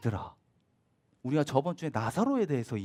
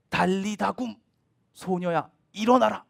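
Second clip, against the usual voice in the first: commanding call; 5 semitones higher, 7 dB louder than usual. Acted. Jesus raises the girl.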